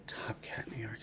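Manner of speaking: whispered Top Cat New York, to himself. Real, not acted. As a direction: breathe the words Top Cat New York while thinking. Repeating the phrase to himself.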